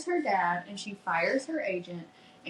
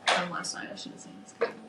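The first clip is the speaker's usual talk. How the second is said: much softer, fading into a whisper; hushed resignation; 3 semitones lower, 7 dB quieter, much faster than usual. Retold or imitated. Retelling the hero's hurt, hushed and fading. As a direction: let it slip out, barely voiced.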